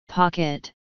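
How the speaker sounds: usual speaking voice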